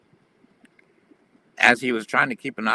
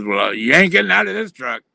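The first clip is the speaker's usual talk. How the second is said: higher, louder mock trucker voice; a raised, put-on tough guy; gruff trucker threat; 6 semitones higher, 5 dB louder than usual. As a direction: voice the menacing trucker.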